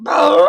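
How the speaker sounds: loud, high trumpet blast; big storybook trumpet call; elephant trumpeting. A narrator's reading. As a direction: blow a long elephant "baroo". Elephant trumpets to scare her.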